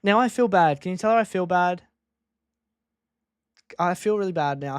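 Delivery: normal talking voice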